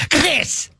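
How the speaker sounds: "[name]" as a harsh, throaty "Khreess"; mock-Germanic pronunciation. Shown as a demonstration. Host demands a new name from co-hosts, "[name]" as throaty "Khreess". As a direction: scrape the start of "[name]" and lengthen its vowel. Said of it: "that's the way my name is really pronounced".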